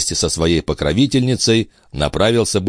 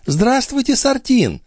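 high-pitched, smiling lady's greeting; serene countess. A narrator's reading. Countess greeting the police chief, serene and high. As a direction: greet him in a much higher feminine voice. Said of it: "приветствовала его с привычной безмятежной улыбкой графиня".

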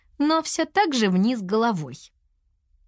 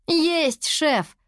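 crisp high-pitched "aye, chief"; saluting; 9 semitones higher than usual. A narrator's reading. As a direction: answer like a cadet saluting. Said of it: "отсалютовала Туа". (бодро, по-военному)

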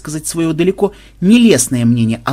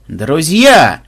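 loud, glad call to all; joyful roar; 2 semitones higher, 6 dB louder than usual. Acted. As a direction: announce with delight. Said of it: "радостно проревел хозяин". (радостный рёв хозяина)

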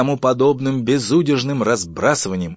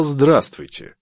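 higher, louder, full ironic greeting; pointed repeat; 6 semitones higher, 7 dB louder, much slower than usual. Acted. (язвительно и громко)

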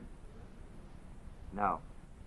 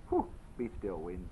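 much softer, quick, hushed command; calm stilling; 6 semitones lower, 10 dB quieter than usual. Retold or imitated. Jesus calmly stills the wind, soft hushed command. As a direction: hush the storm gently.

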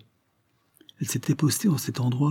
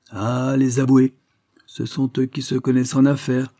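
higher, louder exclamation about the lawyers; exclaiming; 5 semitones higher, 5 dB louder than usual. A narrator's reading. Judge remarks on lawyers' business sense, exclaiming. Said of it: "s'écria le juge Hare".

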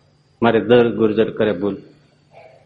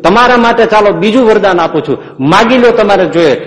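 higher, louder, insistent Maharaj; Maharaj urging; 8 semitones higher, 6 dB louder than usual. Acted. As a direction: press her warmly to ask for herself. Maharaj urges aunt to ask.

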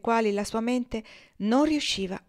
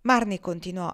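ordinary delivery, nothing marked

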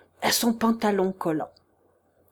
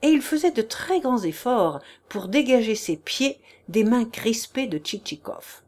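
plain reading voice

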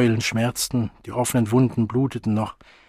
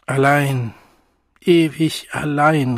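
laut und klagend gerufen; Ausruf; 3 semitones higher, 4 dB louder, slower than usual. A narrator's reading. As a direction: ein verzweifelter Ruf in die Nacht. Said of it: "rief der junge Wilde". (der junge Wilde ruft seine Einsamkeit hinaus)

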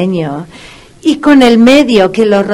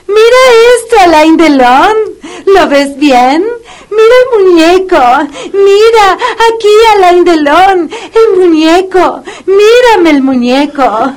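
put-on baby talk to a dog; 9 semitones higher than usual. Vet coaxing the puppy with a toy, high excited baby talk. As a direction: coax the dog like a toddler.

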